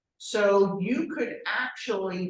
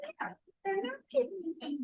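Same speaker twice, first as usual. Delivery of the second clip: high, soft timid reply; small high mock-helpless voice; whiny agent; 13 semitones higher, 8 dB quieter than usual. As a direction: mimic a meek agent shrugging it off. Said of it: "They say".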